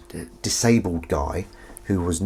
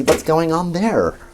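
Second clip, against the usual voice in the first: mock puzzled; 8 semitones higher, slower than usual. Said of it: "It's like".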